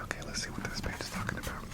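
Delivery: breathy ASMR whisper. Real, not acted. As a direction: whisper it close to the mic.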